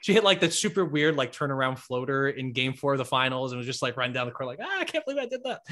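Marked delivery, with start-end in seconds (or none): 4.6-5.6 much higher and fast, mock giddy squeal; playful, high-pitched mock excitement; giddy disbelief. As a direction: imitate a thrilled player running down the court. Player's joy after her shot.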